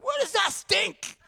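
high, slow mock-disgusted kid voice; disgusted classmates. Pastor mimicking friends mocking his kimchi, high disgusted voice. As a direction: pull a high, disgusted face in the voice. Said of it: "All my friends are like".

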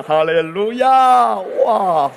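slow, drawn-out hallelujah shout; 哈利路亚. Real, not acted. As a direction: stretch it out and lift the room. Preacher exclaims to congregation. (拖长高声的哈利路亚)

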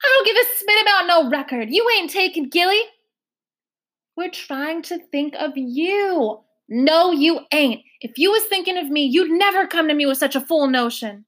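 high, loud, stubborn country voice; angry old foster mother defending her child; furious foster mother. Acted. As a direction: refuse her flat out, heated. Foster mother fighting caseworker.

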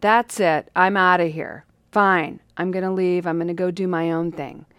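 loud storming-off rant; angry outburst. Retold or imitated. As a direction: snap it out, fed up and leaving. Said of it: "in a heat of anger".

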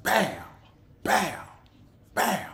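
loud high smacking sounds, one per hit; nightstick blow noises. Retold or imitated. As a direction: punch out each whack like a baton strike. Imitating nightstick blows.

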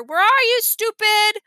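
loud, high call into the fog; shouting into the fog for the parrot. A narrator's reading. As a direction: call for the lost bird. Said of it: "calling to Uncle Feather".

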